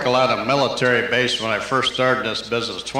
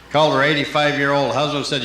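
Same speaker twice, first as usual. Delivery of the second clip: ordinary talk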